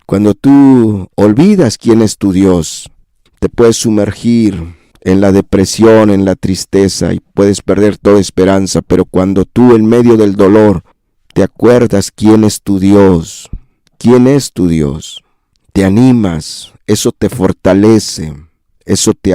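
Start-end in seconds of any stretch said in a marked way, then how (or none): none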